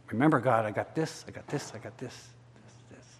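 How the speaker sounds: low voice trailing away to a whisper; fading muttered prayer. Acted. Acts out nagging, repetitive prayer.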